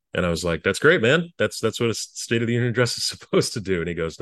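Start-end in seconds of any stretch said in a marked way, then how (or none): 0.6-3.8 higher and louder, gushing with pride; a young speechwriter beaming at his boss; eager delight. Retold or imitated. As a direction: sell it like it's wonderful news, with a laugh. Proud staffer quoting own reply.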